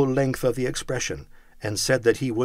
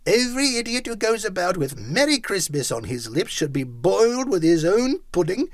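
loud, raised indignant outburst; 8 semitones higher, 4 dB louder than usual. A narrator's reading. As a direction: spit out the scorn indignantly. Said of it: "said Scrooge indignantly".

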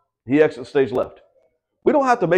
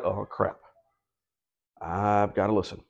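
muttered realization; 11 semitones lower, 12 dB quieter, slower than usual. Retold or imitated. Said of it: "They're like".

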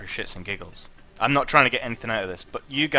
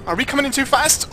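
high worried question; alarmed; 13 semitones higher, 5 dB louder than usual. Real, not acted. Streamer asking viewers, alarmed and high. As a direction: ask it anxiously, voice climbing.

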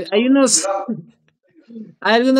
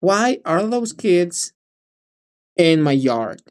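slow linked demo; slower than usual. Shown as a demonstration. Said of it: "es como unirlo".

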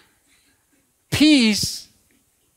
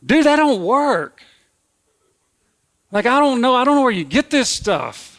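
exasperated retort; 6 semitones higher than usual. Retold or imitated. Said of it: "I'm like".

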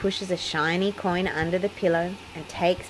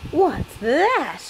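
Peppa's startled little cry, higher; a quick surprised question, pitched up; 8 semitones higher, 3 dB louder than usual. A narrator's reading. Peppa spots something on her plate and cries out. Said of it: "cries Pe-, Peppa cries".

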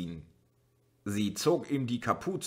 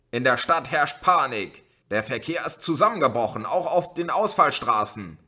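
loud, higher, partly fast shouted warning; bellowing; 5 semitones higher, 5 dB louder than usual. A narrator's reading. Policeman bellowing warnings through the megaphone. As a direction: hammer the bad news at them. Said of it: "brüllte der Polizist mit dem Megafon".

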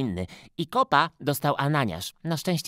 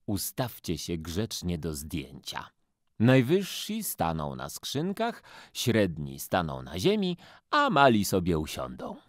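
lower, slow, patient photographer; 6 semitones lower than usual. Acted. Photographer posing the children, a lower, patient voice. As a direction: arrange the kids like a fussy photographer.

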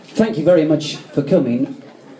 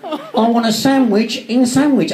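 high-pitched, badly imitated accent; Dave Lee; 9 semitones higher than usual. Friend retelling the sandwich gag, hammed-up bad impression. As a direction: do a poor impression of your mate, pitched up. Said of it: "That impression was so bad".

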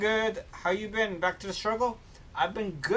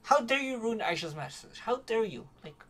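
higher pitched playful scolding; mock outrage; 7 semitones higher than usual. Streamer scolding Twitch's text-to-speech in mock outrage. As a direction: pretend to be offended.

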